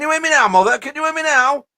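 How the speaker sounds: high, loud catchphrase call; comedian's catchphrase. Acted. Caller greets co-host with an old comedian's line, high loud catchphrase. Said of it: "comes from a comedian".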